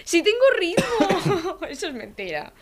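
giggling protest; laughing. Real, not acted. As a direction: laugh through the denial. Teased about rhythm, laughing.

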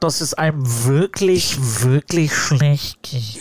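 slow, laboured mock-sick voice; feigned illness. Shown as a demonstration. Host showing how a sick voice message convinces, feigning illness. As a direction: drag out the misery.

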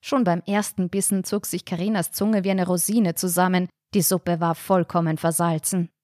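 ordinary delivery

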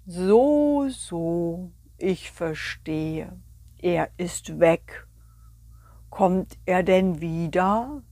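very slow, drawn-out sloth. Acted. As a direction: stretch every word like a sleepy sloth. (langsame Faultierstimme)

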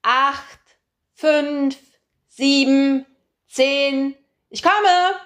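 put-on high, loud, playful little girl counting out; a giddy girl calling out the count in hide-and-seek; cheerful child counting. A narrator's reading. Little girl Mimi counting cheerfully during hide-and-seek. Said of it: "Sie ist fröhlich und ausgelassen".

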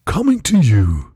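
low, slow blockbuster announcer; movie trailer voice. Acted. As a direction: sell it like a summer trailer. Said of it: "Die klingt nicht so".

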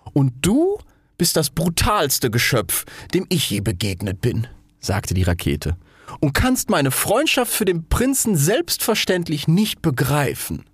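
high then slow, huffy retort; offended rocket. Acted. Rocket retorts to the Bengal fire, huffy. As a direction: hit back with wounded dignity.

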